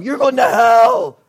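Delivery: loud, high, drawn-out preacher's shout; yelling. Acted. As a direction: bellow a fiery threat at campfire kids. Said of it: "they're, like, yelling".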